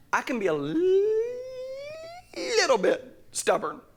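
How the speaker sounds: "li-, little" stretched high and long. Real, not acted. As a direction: tease out "li-, little" before finishing. Comic drawn-out confession about himself.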